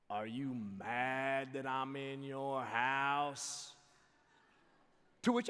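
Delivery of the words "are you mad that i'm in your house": very slow, drawn-out stranger's voice; slow put-on voice of an odd neighbor; creepy stranger. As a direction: imitate a weird intruder, stretching every word. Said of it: "this voice".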